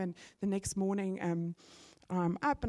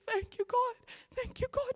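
crying out to God. Retold or imitated. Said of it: "crying out to God".

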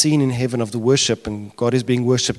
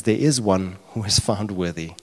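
ordinary delivery

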